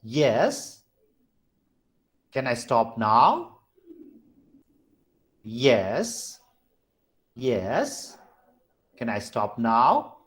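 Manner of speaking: drawn-out questioning rise; rising tone. Shown as a demonstration. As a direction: start low, lift YES and NOW right up.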